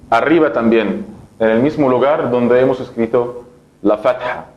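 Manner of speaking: normal talking voice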